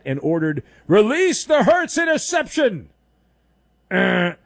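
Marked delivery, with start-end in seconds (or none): 0.9-2.9 mock villain order.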